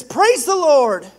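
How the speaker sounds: high, loud, drawn-out call of praise; shouting praise. Acted. Lady asking God for groceries, shouting praise. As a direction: call praise out slowly to heaven. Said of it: "and she shouts".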